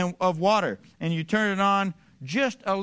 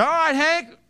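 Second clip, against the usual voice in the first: high, loud holler to a workmate; calling out; 11 semitones higher, 7 dB louder than usual. Acted. Man calling Hank to open the fire hose, calling out. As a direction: yell the go-ahead over a distance.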